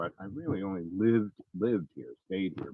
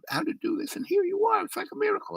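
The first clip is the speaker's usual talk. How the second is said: much higher, bubbling with delight; giggly excited man; faster than usual. Retold or imitated. Volunteer driver gushing to the narrator, high and giggly. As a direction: gush like he can't contain his joy. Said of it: "He starts giggling and jumping up and down".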